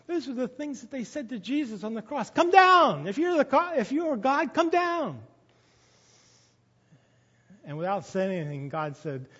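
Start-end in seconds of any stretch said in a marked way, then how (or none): 2.3-5.2 mocking taunt. Mockers taunting Jesus on cross.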